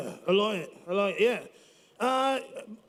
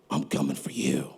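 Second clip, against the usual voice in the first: threatening whisper, much lower; menacing whisper. Acted. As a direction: breathe the threat like a villain. Mock threat to a rival.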